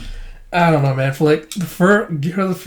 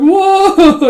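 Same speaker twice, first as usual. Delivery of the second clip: very high, loud whoa; mock shocked cry; 8 dB louder than usual. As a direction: yell out in hammed-up surprise.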